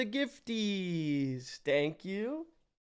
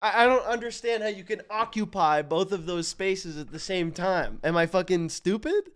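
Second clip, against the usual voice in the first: usual speaking voice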